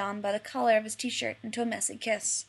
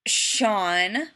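low growl; 5 dB louder than usual. A narrator's reading. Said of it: "John growled".

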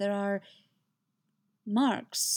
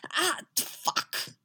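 high, strained curse; spat curse; 8 semitones higher than usual. A narrator's reading. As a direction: spit the swear word out. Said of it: "he spits".

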